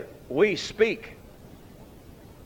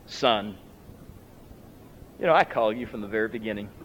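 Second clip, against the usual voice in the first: lower, gentle fatherly voice; loving father; 8 semitones lower, 4 dB quieter, faster than usual. Retold or imitated. Father gently correcting his first son, lower and tender. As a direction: correct him softly, with love. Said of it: "with love".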